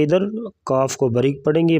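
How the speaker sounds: ordinary talk, nothing marked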